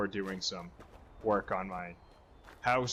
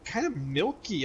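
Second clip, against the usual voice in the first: puzzled; 9 semitones higher, 3 dB quieter than usual. Retold or imitated. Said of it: "and I'm like".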